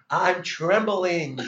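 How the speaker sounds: very slow, loud and high; dramatised trembling. Retold or imitated. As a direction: stretch the words out dramatically. Reliving his fear onstage.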